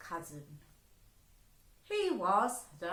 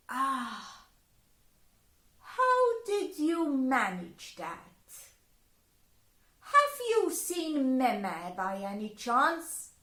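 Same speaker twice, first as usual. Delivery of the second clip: suspicious fairy; 4 semitones higher, 6 dB louder, slower than usual. Acted. Fairies question her.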